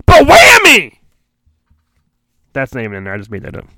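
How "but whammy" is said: high, loud burst of glee; excited exclamation. Acted. Narrator adding a made-up twist, excited shout. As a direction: throw it out like a cartoon sound effect.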